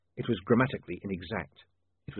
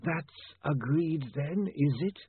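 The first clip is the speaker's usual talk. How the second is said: very slow, high, drawn-out formal voice; zombie lawyer; 7 semitones higher, much slower than usual. Acted. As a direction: stretch each word with chilly formality. Zombie lawyer closes the deal.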